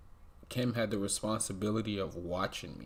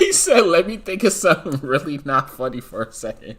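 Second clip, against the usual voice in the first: laughing; 6 semitones higher, 14 dB louder than usual. Real, not acted. Repeats host's line while cracking up.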